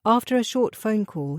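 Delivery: plain reading voice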